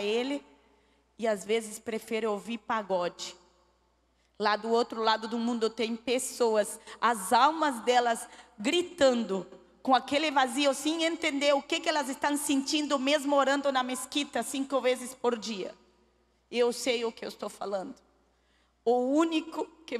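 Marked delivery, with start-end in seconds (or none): 4.4-15.7 louder, pleading preacher's cry; full-force preaching. Acted. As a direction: preach it out, rising to the altar call. Preaching climax to congregation. (pregação forte e inflamada)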